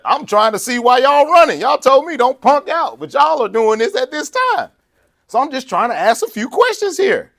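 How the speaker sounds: high, loud, pushing-back reply; defiant retort. Retold or imitated. Preacher voicing David answering his brother, high and defiant. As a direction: call out the cowards, fired up. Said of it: "He's like".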